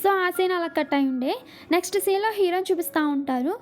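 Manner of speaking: ordinary delivery, nothing marked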